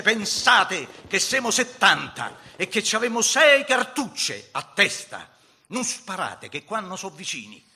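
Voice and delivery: high, loud orders, easing toward the end; undaunted shouting. A narrator's reading. Giovannino warning his men to save cartridges, undaunted shout. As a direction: holler steady orders over the storm of bullets. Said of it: "strillava imperterrito in mezzo alla tempesta".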